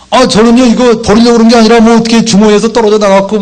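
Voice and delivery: high-pitched mock self-justifying; defensive excuses. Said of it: "얼마나 변명이 많은지 몰라요". (변명을 늘어놓는 높은 목소리)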